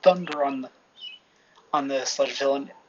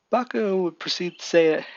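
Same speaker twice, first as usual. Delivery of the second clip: usual speaking voice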